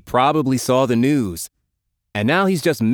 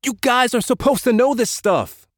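high-pitched exasperation; exasperated scolding; 14 semitones higher than usual. A narrator's reading. Leader scolds his two friends, exasperated. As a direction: chide them for not knowing.

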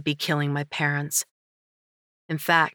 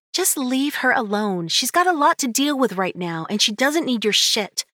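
higher, firm telling-off; scolding sister; 8 semitones higher, 3 dB louder than usual. Acted. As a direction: shield her from him.

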